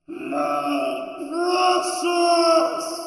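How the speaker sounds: loud, high, drawn-out wail; creature's cry. Acted. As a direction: stretch it out into a high, singing shout. Mimics Gollum's famous cry.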